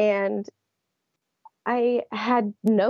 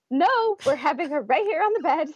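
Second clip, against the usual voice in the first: laughing reply; 7 semitones higher than usual. Retold or imitated. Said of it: "I just laughed and said".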